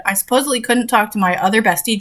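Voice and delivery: ordinary delivery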